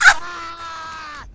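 very loud, long "ah" scream; frustrated scream. Real, not acted. Player seeing the others finish, frustrated scream. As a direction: scream out the defeat.

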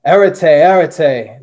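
loud, slow French command to halt; German soldier shouting. Acted. German soldier shouting after a fleeing spy, loud slow command. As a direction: bellow it down the street at a fleeing man. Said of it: "the German S S soldier shouted".

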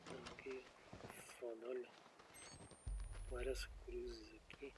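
very soft, slow whisper; tense, keep it low; hushed whisper. Real, not acted. (sussurro tenso)

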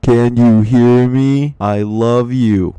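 loud, slow hammed-up love shout; loud mock love. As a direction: bellow love at the fans, slowly.

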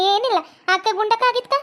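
usual speaking voice

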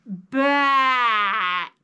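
Bat stretched out slowly, each sound drawn long. Shown as a demonstration. Teacher to kids, slowly stretching out Bat. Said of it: "Let's say it slow".

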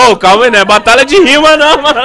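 high and loud through laughter; laughing. Real, not acted. Friend still laughing, telling the others to calm down. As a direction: cackle then protest loudly.

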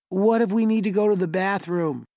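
cheeky plea from the youngest; small boy's question. Acted. Younger brother asking their mother, high and small.

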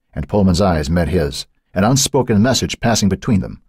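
ordinary delivery

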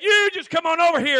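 loud, high call across the yard; hollered dare. Retold or imitated. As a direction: shout the challenge. Retold holler between neighbors.

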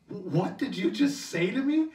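can't believe what you heard; shocked reply. Retold or imitated. Canadian shocked by the gun question, slow disbelief. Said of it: "I said".

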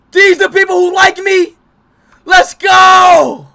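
high, loud celebrating shout; real thrilled shout; excited cheer. Real, not acted. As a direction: hype yourself up, yelling. Sees matches, celebrates.

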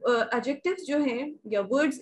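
normal talking voice

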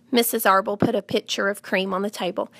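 plain reading voice

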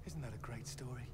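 whisper. Acted. Game character whispers an aside.

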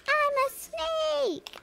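mock snake scream. Mocking the game's snakes, high mock shriek. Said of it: "just screaming".

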